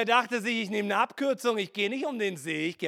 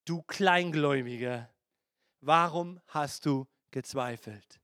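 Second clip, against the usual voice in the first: lower Jesus voice; 8 semitones lower, 4 dB quieter than usual. Acted. Jesus rebukes Peter.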